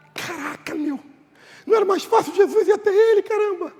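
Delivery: high-pitched, rushed complaint; mock exasperation. Onlooker asking why Jesus won't walk over, mock exasperation. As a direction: grumble in a squeaked, incredulous voice.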